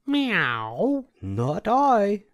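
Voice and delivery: high meowing cat voice; a cat mewing its refusal. A narrator's reading. Cat refusing to help the hen with a high meow. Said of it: "said the cat".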